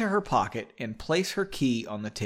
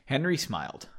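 ordinary delivery, nothing marked

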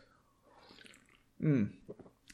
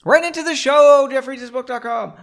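high, loud show-host shout; announcer plug; 11 semitones higher, 8 dB louder than usual. Pretend. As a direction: belt out the website like an announcer.

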